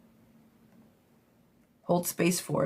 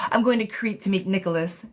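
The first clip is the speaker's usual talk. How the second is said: normal talking voice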